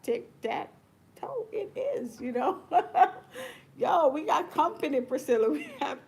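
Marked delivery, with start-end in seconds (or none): 0.0-2.2 high, slow and teasing; playful mock. Teasing students who think class is tic-tac-toe, playful. 2.6-3.5 loud high burst of laughter. Real, not acted. Laughing as company joins the video, delighted.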